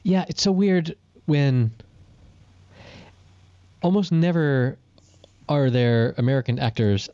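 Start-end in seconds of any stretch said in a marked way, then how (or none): none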